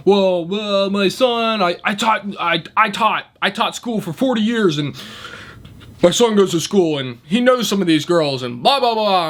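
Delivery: put-on high, stammering old man making excuses; mock flustered excuses. Worker mocks the customer's excuses about his son, high and stammering. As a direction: splutter out the excuses.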